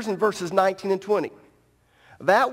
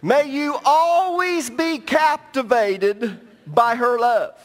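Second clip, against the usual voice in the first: preached scripture; 5 semitones higher, 4 dB louder, slower than usual. Acted. Reads verse with emphasis.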